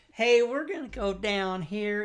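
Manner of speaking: sweet little character leading the way, slowly; cute character voice. Retold or imitated.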